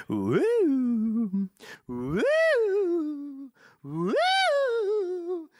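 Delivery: rising eerie hooting; spooky ghost wail. Pretend. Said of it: "You're so spooky".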